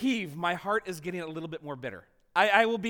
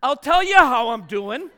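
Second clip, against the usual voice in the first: loud, higher, furious retort; loud angry comeback to the RA; angry outburst; 5 semitones higher, 13 dB louder than usual. Retold or imitated. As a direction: snap back at the gentle question, loud.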